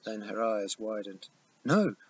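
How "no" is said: loud, sharp cry of alarm; shouted. Acted. Sadie shouts at her father to stop, loud alarmed cry. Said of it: "she shouted".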